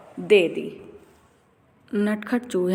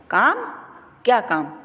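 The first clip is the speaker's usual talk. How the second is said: sharp, much higher disbelieving काम; angry tailor; 3 semitones higher than usual. A narrator's reading. Tailor irritated by the mouse's request, sharp angry question. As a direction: snap back at the mouse. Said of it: "दर्जी ने गुस्से से पूछा".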